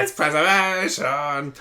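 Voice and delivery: put-on lightly done Birmingham accent, slowed; a hint of a Brummy shout. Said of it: "in a Brummy accent".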